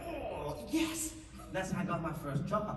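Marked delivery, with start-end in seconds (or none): 0.1-1.1 high, awed mock 'yes'; impressed onlooker, played up; imitated delight. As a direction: draw out the oh, then a high yes. Casting people thrilled by his dive.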